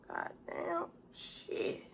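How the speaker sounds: breathless whispered swearing; whispered curse. Real, not acted. Eating alone on camera, muttering a whispered curse. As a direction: hiss it under your breath.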